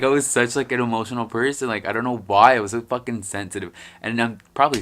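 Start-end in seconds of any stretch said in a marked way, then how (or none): none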